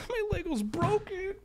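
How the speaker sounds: high, soft, mock-tearful whimper; whiny mock sob. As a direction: whimper it like a sulking kid. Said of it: "then you go".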